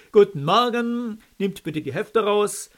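loud, high, cheery commanding tone; strict teacher. A narrator's reading. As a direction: bark orders at a class. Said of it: "in launigem Kommandoton begrüßte".

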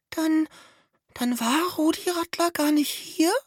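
very high, hesitant squeaky voice; ängstliche Mäusestimme, sehr hoch; timid little mouse. A narrator's reading. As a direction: peep it out, still scared. Frightened little mouse asks.

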